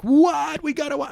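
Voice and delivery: very high excited squeal; imitated thrilled girlfriend. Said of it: "my girlfriend was so happy".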